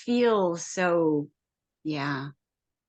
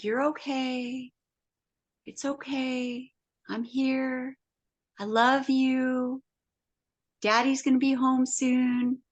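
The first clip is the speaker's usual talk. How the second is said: reassuring; 4 semitones higher than usual. Retold or imitated. Woman soothing the neighbour's lonely beagle, slow and tender. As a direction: gently comfort a scared pet. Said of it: "I'm like".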